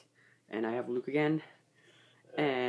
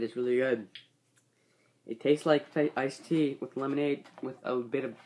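muffled behind hand; speaking through fingers; hand over mouth. Real, not acted. Seen 0: Hand raised to cover mouth from 1.6s, during "really good.

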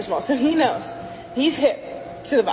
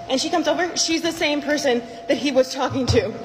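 ordinary talk

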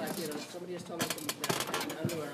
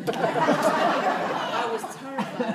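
laughing; 9 semitones higher, 13 dB louder, much slower than usual. Real, not acted. Laughing at her own fear.

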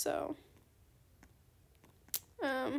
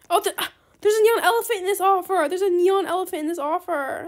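excited gasp; 3 semitones higher, 9 dB louder than usual. Real, not acted. Gamer spotting a neon elephant in the offer, thrilled shout. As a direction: blurt it out like you just struck gold. Spots a rare pet in trade.

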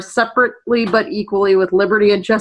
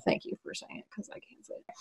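breathy whispered aside; whispering; 22 dB quieter, faster than usual. Real, not acted. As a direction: whisper it to yourself. Aside while co-host reads name.

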